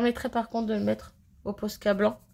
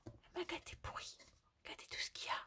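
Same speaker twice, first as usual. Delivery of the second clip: secretive whisper; hushed thrilled whisper; 9 semitones higher, 22 dB quieter, faster than usual. Real, not acted. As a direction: breathe it out like a delighted secret. Unboxing gift, repeats line in whisper.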